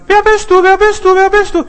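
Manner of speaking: very high, loud repeated calls; echo from the forest. Acted. Forest answers the child, loud high repeating echo. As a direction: imitate an echo ringing back. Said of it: "Das hallt aus dem Wald raus".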